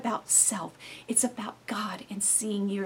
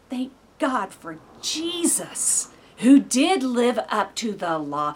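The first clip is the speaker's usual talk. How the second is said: ordinary talk, nothing marked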